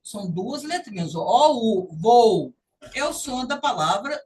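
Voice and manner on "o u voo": "voo" slow with an ou glide; slow, loud "voo" with diphthong; voo demo. Shown as a demonstration. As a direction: stretch "voo" as O U. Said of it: "eu vou falar voo".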